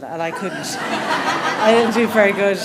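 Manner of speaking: chuckling at her own failed attempt; laughing. Real, not acted. [name] laughing at her failed try at Peter's accent.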